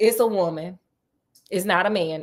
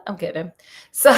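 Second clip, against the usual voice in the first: ordinary delivery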